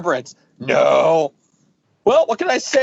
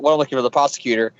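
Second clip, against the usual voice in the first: ordinary delivery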